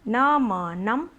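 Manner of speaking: न म नम, very slow, syllables apart; sounded out slowly. Shown as a demonstration. Teacher sounds out a word for pupils. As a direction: sound out न, म, then नम.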